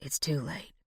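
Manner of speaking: low, breath-only, very soft; dying whisper. A narrator's reading. Dying Truman to Jessica, faint whisper. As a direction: whisper with the last of your strength. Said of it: "Truman whispered".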